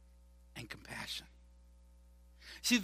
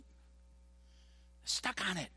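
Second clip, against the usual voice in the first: zoned-out whisper; 2 semitones higher, 8 dB quieter, much slower than usual. Retold or imitated. Mocking zoned-out gamers.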